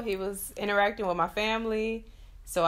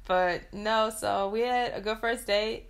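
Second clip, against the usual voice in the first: ordinary talk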